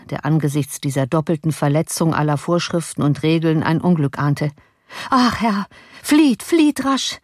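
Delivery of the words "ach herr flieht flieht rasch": high, drawn-out cry of alarm; alarmed plea. A narrator's reading. Frightened servant warning his master, alarmed plea. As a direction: urge him desperately to get away.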